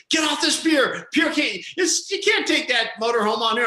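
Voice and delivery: high-pitched urgent shout, retold; restaurant guy yelling. Restaurant guy runs out to stop the motorhome, yelling. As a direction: imitate a man hollering a warning. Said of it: "a guy comes running out real quick".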